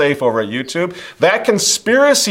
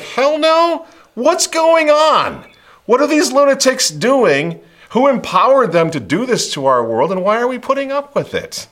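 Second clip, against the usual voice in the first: much higher outraged everyman, slowed on going; exasperated everyman; 12 semitones higher, 3 dB louder than usual. Retold or imitated. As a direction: play fed-up normal people. People fed up, imitated.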